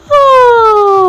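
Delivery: a sudden jump into a high cracked "Oh"; voice breaking high. Shown as a demonstration. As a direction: let the "Oh" flip up into a break. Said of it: "when it goes high, I go".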